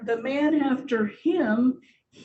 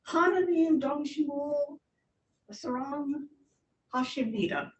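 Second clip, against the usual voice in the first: halting first attempt; 3 semitones higher than usual. Shown as a demonstration. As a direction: piece by piece, pausing between words. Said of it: "Try it again".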